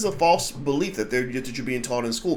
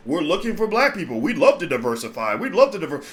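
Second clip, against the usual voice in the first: mock diversity statement; 3 semitones higher than usual. Mocking a university diversity statement.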